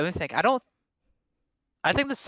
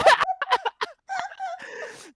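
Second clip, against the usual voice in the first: burst of laughter. Real, not acted. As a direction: crack up at himself. Laughing at own bad accent.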